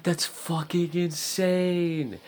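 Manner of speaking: high, drawn-out squeal of disbelief; retold amazement. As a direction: stretch every word in high astonishment.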